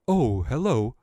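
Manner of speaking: a hint of a toy hippo; friendly hippo shopkeeper. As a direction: greet the customer politely. Itolo greets a customer.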